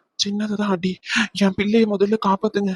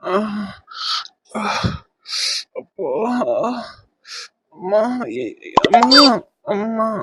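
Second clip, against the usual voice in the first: groaning in pain; 4 semitones higher, slower than usual. Acted. Man hurt after being pushed.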